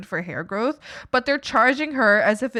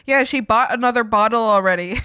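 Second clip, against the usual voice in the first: ordinary talk, nothing marked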